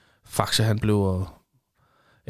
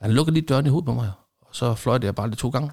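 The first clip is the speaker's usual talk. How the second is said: usual speaking voice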